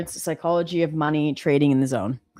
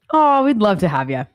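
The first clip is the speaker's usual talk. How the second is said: excited welcome; 4 semitones higher, 6 dB louder than usual. Real, not acted. Welcomes viewer enthusiastically.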